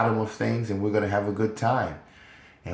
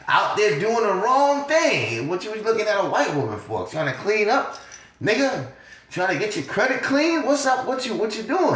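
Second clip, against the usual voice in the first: scolding onlooker; 8 semitones higher than usual. Retold or imitated.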